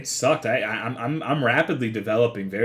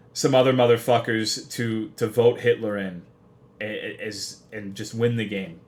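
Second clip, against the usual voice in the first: ordinary delivery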